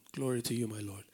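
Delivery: low, soft murmur of praise; hushed prayer. Real, not acted. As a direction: drop the voice to address God privately. Murmured aside addressed to God.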